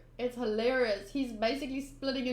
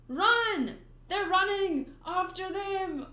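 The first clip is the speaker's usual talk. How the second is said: high, urgent calling out; chase shouts; 6 semitones higher, 3 dB louder, slower than usual. Acted. Pirates fleeing, townspeople chasing, shouting.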